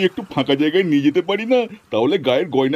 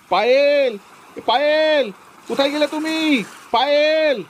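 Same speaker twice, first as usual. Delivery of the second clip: calling out; 6 semitones higher, much slower than usual. Acted. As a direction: shout her name into the distance. Husband searching for missing wife. (উঁচু গলায় ব্যাকুল ডাক)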